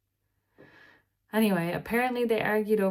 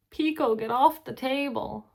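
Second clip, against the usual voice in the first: higher, louder call to the cat; sharp command to a pet on the table; scolding pet; 6 semitones higher, 5 dB louder than usual. Real, not acted. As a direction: owner calling the cat off the table.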